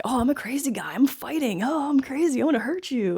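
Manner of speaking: high, singsong crazy-guy taunt; mock maniac. Viewer mocking the villain's bad acting, singsong maniacal. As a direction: send up a hammy grinning lunatic. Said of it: "maniacal, like, this guy's just crazy".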